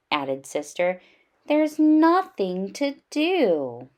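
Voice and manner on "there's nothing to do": bored whine. Acted. Bored cub complains.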